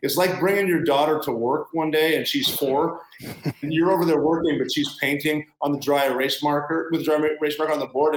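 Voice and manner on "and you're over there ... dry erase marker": normal talking voice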